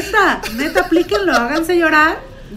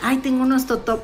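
inner temptation voice; 6 semitones lower, 7 dB quieter than usual. Retold or imitated. Dieter talking herself into more.

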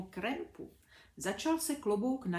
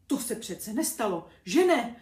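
frightened; 2 semitones higher, 8 dB louder than usual. A narrator's reading. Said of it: "zeptal se vylekaně".

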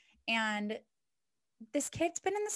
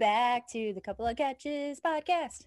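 sultry host voice; 6 semitones higher than usual. Acted. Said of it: "Too much".

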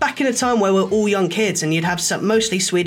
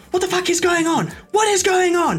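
shocked teacher yell; 13 semitones higher than usual. Retold or imitated. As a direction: yell in disbelief at the students. Teacher yelling at students.

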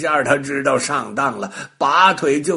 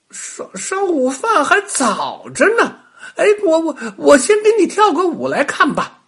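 high, loud eager rabbit; bunny voice; 6 dB louder than usual. A narrator's reading. Rabbit coaxes the witch to watch a dance, high and eager. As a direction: coax her down playfully.

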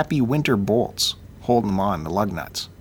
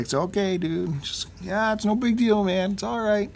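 soft, much higher imitation of a pal; easygoing friend reassuring; 8 semitones higher, 4 dB quieter than usual. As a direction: brush it off gently in a high, quiet voice. Friend's reassurance, voiced by teller.

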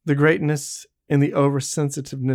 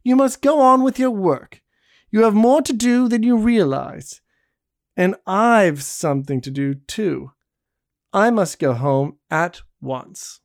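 higher, louder firm orders; bossy refusal; 5 semitones higher, 4 dB louder than usual. A narrator's reading. Barbe orders Jean to keep working, brisk. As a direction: send him back to work.

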